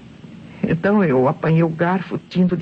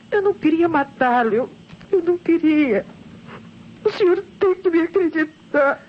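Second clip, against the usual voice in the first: higher, pleading voice rising in distress; a hint of a voice about to break; desperate pleading; 8 semitones higher than usual. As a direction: beg the inspector to believe you. Murderess begs the inspector.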